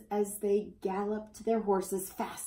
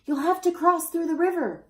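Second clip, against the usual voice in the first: high, loud call across the river; crying out; 10 semitones higher, 7 dB louder than usual. A narrator's reading. Citizens call to the children across the river, loud and high. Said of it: "cried the citizens".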